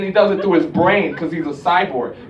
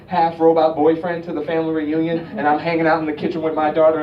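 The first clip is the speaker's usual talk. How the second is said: ordinary talk, nothing marked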